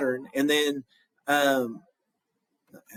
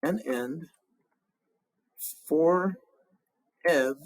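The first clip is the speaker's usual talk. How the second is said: counting syllables; much slower than usual. Shown as a demonstration. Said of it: "I've got new slash marks here".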